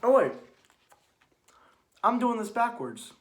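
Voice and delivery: oh no, I messed up; sudden realisation. Real, not acted. Catching his own mistake while explaining the scene, startled.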